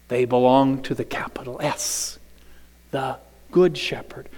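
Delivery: unvoiced hush; hushed aside; whispered emphasis. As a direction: drop to a reverent whisper. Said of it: "whispered emphasis".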